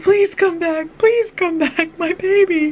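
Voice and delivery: high, urgent cry after someone leaving; desperate pleading. Retold or imitated. Mother running after police officer, calling desperately. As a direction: re-enact a frantic parent calling out.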